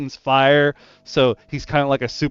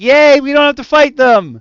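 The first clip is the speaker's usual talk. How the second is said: much higher, louder mock cheer; 14 semitones higher, 11 dB louder than usual. Players cheering that the fight is skipped, mock cheer. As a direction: cheer like the party got off easy. Party cheers in GM's retelling.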